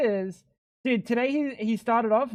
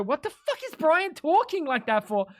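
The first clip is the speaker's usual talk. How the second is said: confused outburst; 8 semitones higher than usual. Retold or imitated. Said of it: "sound like a fucking crackhead when I go".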